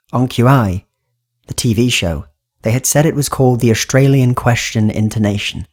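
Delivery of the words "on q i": plain reading voice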